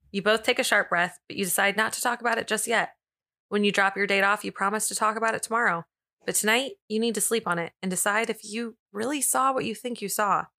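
ordinary talk, nothing marked

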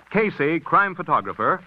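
normal talking voice

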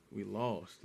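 Captain America admitting total defeat, softly. Retold or imitated. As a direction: barely get the words out after the sigh. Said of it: "deep defeated breaths".

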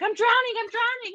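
high-pitched, imitated kid in a panic; mock panic. Kid flailing in a shallow pond, mock panic. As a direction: cry out like a child who thinks they're drowning. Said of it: "they're panicking".

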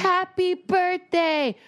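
high, drawn-out birthday greeting; birthday cheer. Retold or imitated. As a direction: stretch out a high, sing-song cheer. Calling the girls on FaceTime.

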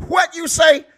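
loud, high-pitched impression. Comic riffing on the fly, loud high impression. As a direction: punch it up high in a big impression.

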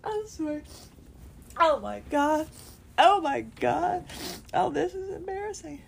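fast, tearful and embarrassed; tearing up, half-laughing at herself; crying. Real, not acted. Apologizing while crying.

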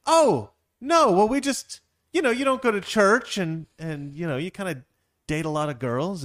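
high acted protest, loud then easing down; awkward backpedalling. Couple explains to offended friend why he's excluded, backpedalling.

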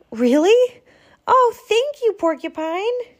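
high, loud squeal of thanks; delighted squirrel. Acted. Squirrel thanks Porcupine for the flour, high and delighted. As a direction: burst with grateful surprise.

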